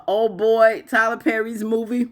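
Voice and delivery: usual speaking voice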